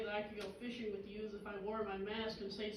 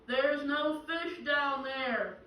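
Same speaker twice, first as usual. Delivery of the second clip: slow, loud, higher booming proclamation; voice from above; 4 semitones higher, 6 dB louder, much slower than usual. Acted. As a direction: call it down from the heavens, drawn out. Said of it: "a voice from above says".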